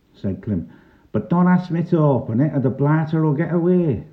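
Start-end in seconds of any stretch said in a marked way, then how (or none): 1.1-4.1 high-pitched, insistent character voice; warning dockworker. A narrator's reading. Clem warns the bobby off his box, high and insistent. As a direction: warn him off, pitched well up.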